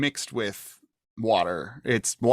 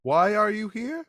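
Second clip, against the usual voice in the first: high woman's voice, rising at the end; townswoman; 9 semitones higher than usual. Acted. Woman asks a visitor the reason, high rising voice. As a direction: question the visitor in a raised pitch.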